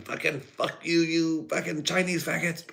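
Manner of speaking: hammed-up high cartoon voice. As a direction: squeak it out as a mocking caricature. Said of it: "Say Japanese shit".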